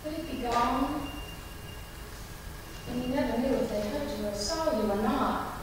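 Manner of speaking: very soft letdown; deflated. Acted. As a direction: drop to a hushed, disappointed tone. Let down as helicopter leaves.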